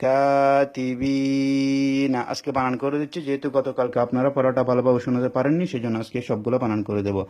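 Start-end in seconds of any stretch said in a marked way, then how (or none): 0.0-2.2 very slow chanted কিরামান কাতিবিনা; measured tajweed chant; Quran recitation. Shown as a demonstration. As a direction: recite it like in the mosque, stretching the alif. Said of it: "এখানে এক আলিফ টানতে হবে".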